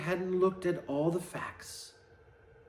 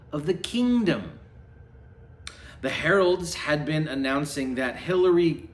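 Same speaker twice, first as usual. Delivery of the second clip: plain reading voice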